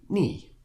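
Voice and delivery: fourth-tone "ni", falling. Shown as a demonstration. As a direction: drop the pitch firmly through "ni". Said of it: "or the fourth tone falling".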